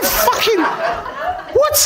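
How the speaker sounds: high, incredulous outburst; acting out a baffled, angry reply; indignant retort. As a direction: fire back in high-pitched disbelief. Retold shocked reply to shouting.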